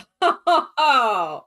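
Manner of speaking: loud, high real laugh; burst of laughter. Real, not acted. Listener laughs hard at the French Shin joke.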